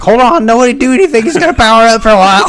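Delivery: put-on high, goofy kid voice; dopey friend voice. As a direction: play a dim kid calling out attacks. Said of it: "He's like".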